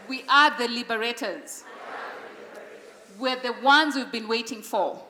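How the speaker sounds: loud, firm declaration for the crowd to echo; with conviction. Shown as a demonstration. Speaker leading an audience in affirmations, loud and firm. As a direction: call out each affirmation so they repeat it. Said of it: "Please say this with conviction".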